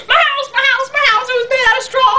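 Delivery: high-pitched mock panic of a pig; imitated freak-out. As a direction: wail it fast like a cartoon pig. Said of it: "he is just freaking out".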